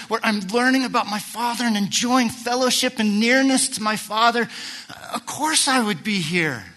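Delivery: high, loud astonished pleading; boy Jesus, amazed. Retold or imitated. Boy Jesus answering his mother, high and amazed. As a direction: marvel that they did not know. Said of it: "I could put it into later terms".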